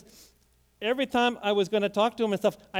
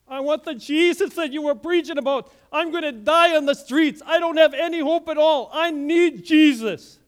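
higher, louder cry for help, slowing at the end; desperate plea; 7 semitones higher, 8 dB louder than usual. Retold or imitated. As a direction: plead as if life depends on it. Homeless man begs for Jesus.

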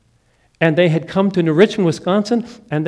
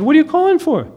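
mom's surprised question; 11 semitones higher, faster than usual. Retold or imitated. Mom answers his phone call.

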